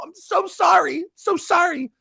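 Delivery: very high, squeaky "so sorry"; mock high-pitched apology. Host mocking a girl apologizing to online trolls in a squeaky voice. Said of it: "She's all".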